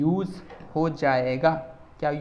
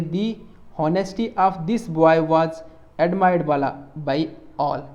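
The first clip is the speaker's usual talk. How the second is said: very slow, louder, word by word; slow dictated example; 4 dB louder, much slower than usual. Shown as a demonstration. Teacher dictating an example sentence to students, slow and loud. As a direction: dictate the sentence to the class slowly. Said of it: "जैसे हम लिखेंगे".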